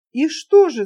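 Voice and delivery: impatient outburst; impatient cry. A narrator's reading. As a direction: call out, dying to hear the end. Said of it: "закричал я в нетерпении".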